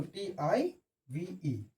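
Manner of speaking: slow letter by letter spelling. Shown as a demonstration. Teacher spells the word's ending for students, slow. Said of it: "spells the ending".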